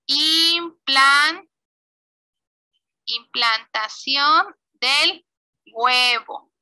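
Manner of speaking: drawn-out words with gaps while typing; slow dictation. Real, not acted. Teacher typing the slide label for pupils, slow dictation. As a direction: spell it out slowly, piece by piece.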